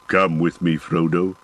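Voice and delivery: a hint of a dwarf urging his friend along; dwarf calling. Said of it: "cried the dwarf".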